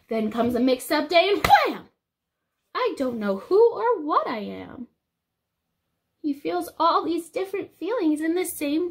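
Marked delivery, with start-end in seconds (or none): none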